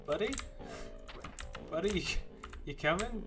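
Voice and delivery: calling out. Real, not acted. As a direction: call to a straggling companion. Calling to a companion.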